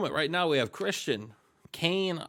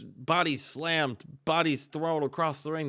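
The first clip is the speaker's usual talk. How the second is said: ordinary talk, nothing marked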